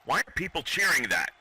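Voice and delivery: normal talking voice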